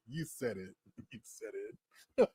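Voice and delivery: very soft, with a laugh in it; barely voiced, trailing-off repeat; fading amused retort. Real, not acted. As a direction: let it die away under the laughter.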